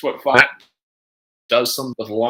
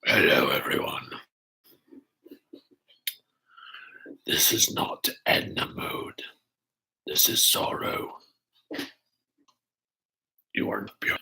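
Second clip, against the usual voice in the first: soft breathy whisper in a put-on voice; whispered character voice; 13 semitones lower, 9 dB quieter than usual. Streamer hams up a mystery character to chat, whispering. Whispered spoof of Edna Mode.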